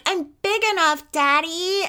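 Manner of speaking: put-on high little-girl voice; mock a small girl insisting to her dad; child insisting. Daughter insisting to her dad that she's big enough, high childlike voice.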